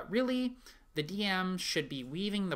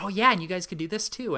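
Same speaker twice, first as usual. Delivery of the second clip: loud, higher, excited suggestion; eager joining in; 5 semitones higher, 8 dB louder, faster than usual. Retold or imitated. Dungeon master forgetting the role while strategizing with players, acted excitement. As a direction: act out getting carried away with an idea.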